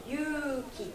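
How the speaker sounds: Yuki said short, two even beats; Yuki, clipped to two beats; model word. Shown as a demonstration. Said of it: "Mais on compte un temps pareil".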